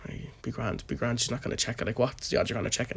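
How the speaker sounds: hushed whisper among workmates; conspiratorial whispered reassurance. Retold or imitated. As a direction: whisper so the boss won't hear. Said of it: "we're all standing there like".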